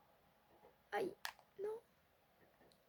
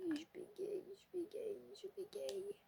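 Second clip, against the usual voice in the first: higher and much softer, a put-on little voice; mock stutter; 7 semitones higher, 11 dB quieter, slower than usual. Shown as a demonstration. Tired gamer mocking her own stammer to viewers, squeaky and soft. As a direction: squeak it out, teasing yourself.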